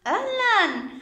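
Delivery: put-on a big singsong hello to little kids; high, loud cheerful greeting for children; bright high greeting. Shown as a demonstration. Teacher greets class brightly.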